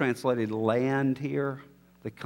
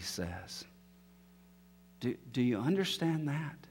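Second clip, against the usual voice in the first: softer, lower, hushed reflection; quiet and sombre; 5 semitones lower, 7 dB quieter than usual. Acted. Preacher softly telling listeners history would be meaningless, hushed. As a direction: let it sink in quietly, almost to yourself.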